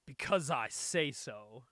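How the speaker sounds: low, slow, deliberate retort; firm comeback. Retold or imitated. Character answering a demand for a reason, firm slow retort. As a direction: drop the pitch and spell it out slowly. Acted comeback in comic retelling.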